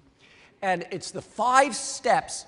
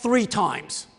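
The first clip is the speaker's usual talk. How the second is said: high, loud, slow exasperated rant; 7 semitones higher, 8 dB louder, slower than usual. Retold or imitated. Dentist comparing careless patient to his daughter, exasperated. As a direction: hammer it out in loud disbelief, dropping at the end.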